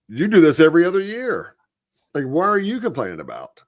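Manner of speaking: indignant and fast; exasperated rebuke. Acted. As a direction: call them out, pitch climbing. Mock rebuke of complaining politicians.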